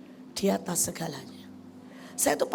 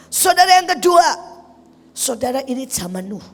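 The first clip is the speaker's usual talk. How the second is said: fiery preaching; 3 semitones higher, 9 dB louder than usual. Acted. Pastor preaching to the congregation about scorn, fiery. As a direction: preach it hard to the crowd.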